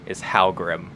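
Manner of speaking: normal talking voice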